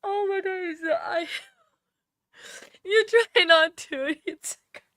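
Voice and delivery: a sobbing cry breaking into a laugh, then a whisper; real crying. Real, not acted. Streamer thanking her community, crying openly. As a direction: let the tears win, then laugh through them. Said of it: "Sorry, I'm crying".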